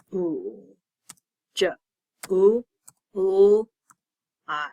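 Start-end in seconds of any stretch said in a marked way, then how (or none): 2.3-3.7 U and L said out loud and firmly; letter sounds called out. Shown as a demonstration. Teacher sounding out July letter by letter, loud. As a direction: call out each letter sound to the class. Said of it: "So J".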